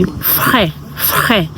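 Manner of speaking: "frère" as "freh", r in the throat; French pronunciation. Shown as a demonstration. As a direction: repeat "frère" with a falling tune. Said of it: "you say".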